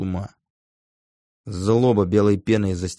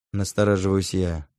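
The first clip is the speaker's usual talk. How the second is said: ordinary delivery, nothing marked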